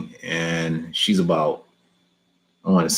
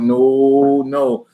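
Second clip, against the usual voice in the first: higher, louder playful panicked no; mock fearful no; 6 semitones higher, 4 dB louder than usual. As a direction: recoil in pretend horror, half laughing. Imagining the heat of hell.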